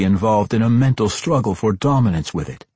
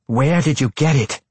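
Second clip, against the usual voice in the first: higher-pitched, insistent questioning; angry demand; 7 semitones higher, 3 dB louder than usual. A narrator's reading. Matron turns back on Tosin, angrily demanding. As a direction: demand an answer with some anger. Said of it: "She turned back on Tosin angrily".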